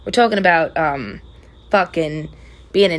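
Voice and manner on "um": usual speaking voice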